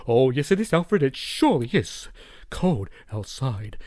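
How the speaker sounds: a hint of a refined gentleman, slowing at the end; lightly voiced polite millionaire, drawn out on the last words; Bruce Wayne voice. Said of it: "this is how Bruce Wayne's gonna sound".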